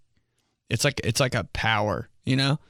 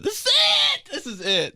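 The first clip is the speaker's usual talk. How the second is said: squeaky hype; 3 dB louder than usual. Shown as a demonstration. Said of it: "Okay, my awkward ways".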